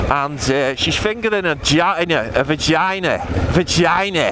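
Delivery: high-pitched mock Liverpudlian; Scouse accent. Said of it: "Try that in a Scouse accent".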